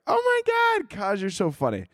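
squealing high-pitched mock fan voice; gushing fan. Mimicking fans who praise him, squealing high and gushing. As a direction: gush like an adoring fan. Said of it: "you guys are like".

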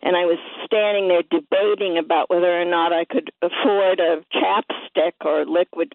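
ordinary talk, nothing marked

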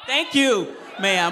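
loud, high, playful thank you; a booming mock-grateful thank you. Shown as a demonstration. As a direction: thank her big and loud. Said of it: "Sounds so much better when you say it".

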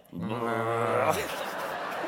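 long, low mumbled sheep noise; imitated sheep mumble. Guest mimics mumbling sheep for host, drawn-out noise. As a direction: mumble wordlessly like a New Zealand sheep. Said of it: "They mumble?".

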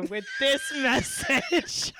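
slow, drawn out through laughter; breaking into real giggles; laughing. Real, not acted. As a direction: lose it laughing mid-phrase. Cracks up announcing the reply.

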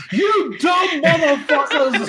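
high, drawn-out mock British shout through laughter; drunk British accent. Joking insult hurled at friends on a podcast, hammed-up British accent. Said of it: "was that your best British accent?".

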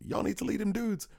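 pitched far up, a silly imitated shout; mock drunken yell. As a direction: squeak it out like a drunk friend hollering. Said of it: "he leans out and yells".

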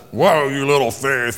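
slow, mock stern scolding; harsh rebuke. Jesus scolding Peter harshly, slow mock sternness. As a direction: scold him like a disappointed boss. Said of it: "This isn't a".

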